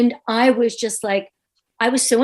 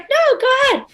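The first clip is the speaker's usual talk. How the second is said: much higher, light imitation of the host; high mock reply; 4 dB louder than usual. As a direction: pipe it up, breezy. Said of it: "he's like".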